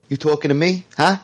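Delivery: Robert De Niro impression. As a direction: challenge him, lightly voiced tough guy. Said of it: "if I was Robert De Niro, Taxi Driver, I'd say".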